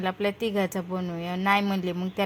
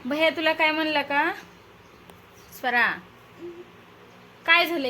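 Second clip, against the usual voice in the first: mother urging her sulking daughter to talk, raised coaxing voice; coaxing call; 7 semitones higher, 6 dB louder than usual. Real, not acted. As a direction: lift the voice and plead gently.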